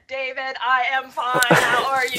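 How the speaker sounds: mock computer voice, slow and word by word; Tim. Co-host mimicking a friend's stiff greeting, computer-like. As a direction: imitate a friend talking like a computer. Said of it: "This is like computer Tim".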